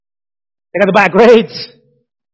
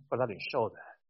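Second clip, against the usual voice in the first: ordinary delivery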